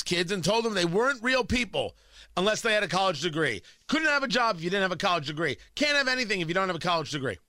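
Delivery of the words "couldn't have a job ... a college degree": ordinary talk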